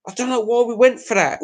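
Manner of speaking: ordinary talk, nothing marked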